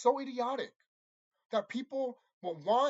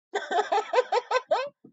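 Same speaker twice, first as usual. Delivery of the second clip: incredulous laugh; 6 dB louder than usual. Real, not acted. Laughs at the name Steve.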